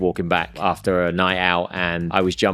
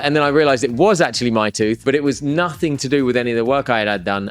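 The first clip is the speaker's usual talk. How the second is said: ordinary talk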